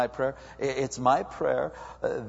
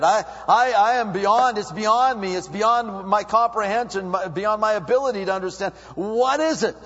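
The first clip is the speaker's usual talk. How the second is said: higher, louder, bewildered questioning; confused believer; 7 semitones higher, 5 dB louder than usual. Retold or imitated. Preacher mimicking a confused believer asking God why. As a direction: act out the puzzled believer. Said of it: "you're going".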